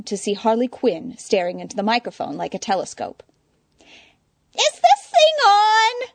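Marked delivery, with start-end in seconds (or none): 4.5-6.1 very high, slow, loud squeak; squeaky. Acted. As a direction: squeak it into the mic. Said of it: "she squeaked".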